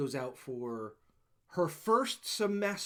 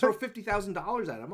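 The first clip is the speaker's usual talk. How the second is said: ordinary delivery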